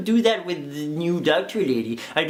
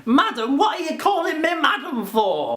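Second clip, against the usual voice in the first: offended Doctor; 8 semitones higher than usual. Pretend. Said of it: "the Doctor is like".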